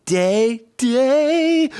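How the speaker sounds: eager high call of longing; yearning call. Acted. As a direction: reach up high on the last day. Little E wants to lug.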